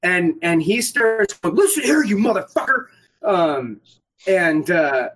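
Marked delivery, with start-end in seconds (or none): 1.5-2.9 higher, confrontational imitation; mock aggressor. Player imitating the angry opponent confronting him, hammed-up aggression. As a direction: square up with a mock angry opener.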